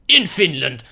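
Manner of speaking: mock Finnish accent, punched out; bad Finnish accent. Gamer retrying after needing to pee, bad Finnish accent. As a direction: announce it in a fake Finnish accent. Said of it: "I cannot do your accent".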